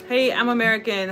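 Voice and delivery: ordinary talk, nothing marked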